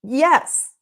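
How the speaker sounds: a light, excited little cheer; student thrilled he finally got through. Retold or imitated. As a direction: celebrate the small win, lightly voiced. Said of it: "the man's super excited now".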